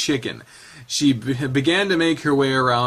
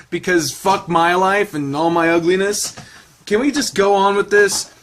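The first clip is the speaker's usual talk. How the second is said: ordinary delivery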